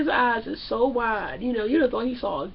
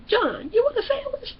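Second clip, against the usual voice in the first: high, sing-out offer with a rising end; put-on high girlfriend voice; imitated girlfriend; 11 semitones higher than usual. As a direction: call it out high and sweetly.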